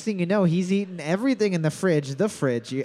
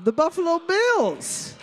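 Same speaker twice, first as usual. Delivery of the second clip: high excited reveal; 10 semitones higher than usual. Acted.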